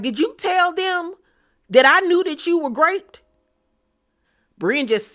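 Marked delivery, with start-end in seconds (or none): 0.0-3.2 much higher, outraged demand; confrontational. Retold or imitated. Uba confronting Brienne, high indignant demand. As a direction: confront her, voice jumping high. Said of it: "Uba gets up and was like".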